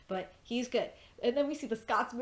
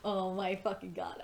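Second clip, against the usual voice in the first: ordinary talk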